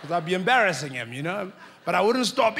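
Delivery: normal talking voice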